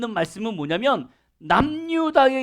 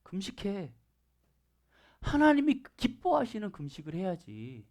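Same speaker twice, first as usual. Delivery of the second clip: soft scolding; 4 semitones lower, 9 dB quieter than usual. Retold or imitated. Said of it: "그랬더니 여기서 그러는 거죠".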